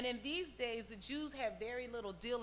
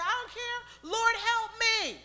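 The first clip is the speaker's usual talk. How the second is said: loud, very high desperate wail; crying out; 10 semitones higher, 8 dB louder, slower than usual. Acted. Kneeling woman begs Jesus for help, crying out. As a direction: plead at the top, stretching the last words. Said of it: "She kept screaming".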